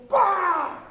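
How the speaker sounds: a loud, high mock "Bah!" of a kid charging; mock wild lunge yell. Coach mocking a reckless lineman, loud mock grunt. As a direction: bark out a cartoonish lunge cry, mocking it. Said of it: "Just going".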